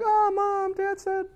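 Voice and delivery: put-on whiny little kid telling on someone; tattling kid.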